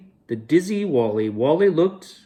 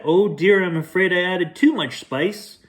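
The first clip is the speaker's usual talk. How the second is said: lightly high worried baker; a hint of nervous baker; fretting baker; 6 semitones higher than usual. Baker confesses too much spice, lightly fretful and high.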